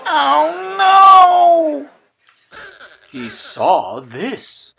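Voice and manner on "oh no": loud, high shout of dismay; he sees the mixed-up town, shocked; dismayed cry. A narrator's reading.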